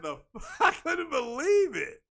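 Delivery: real laughter breaking into the words; laughing disbelief. Real, not acted. Podcaster cracking up over what he heard, laughing disbelief. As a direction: talk through helpless, high-pitched laughing.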